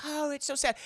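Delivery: high mock sympathy; lightly done fake pity; a hint of hollow pity. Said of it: "And they're like".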